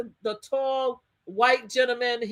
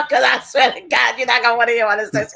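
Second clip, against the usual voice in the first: laughing warning; 7 dB louder than usual. Retold or imitated. Warning the survey woman, laughing.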